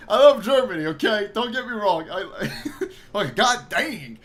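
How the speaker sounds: I love Germany, laughing; laughing insistence. Real, not acted. Laughing protest after joke.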